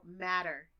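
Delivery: matter, the TT flapped to a quick D; American flap T. Shown as a demonstration. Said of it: "when Americans say a double T, it sounds like a D".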